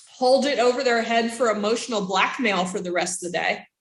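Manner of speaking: normal talking voice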